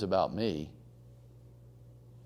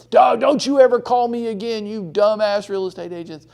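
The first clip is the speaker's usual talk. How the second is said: much louder and higher, furious shouting; hostile yell; 10 semitones higher, 9 dB louder than usual. Acted. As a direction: scream at the agent to never call again. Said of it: "The louder I get".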